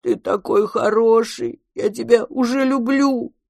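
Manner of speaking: put-on big baby monster, declaring affection; childlike monster. A narrator's reading. Monster clings to new mama.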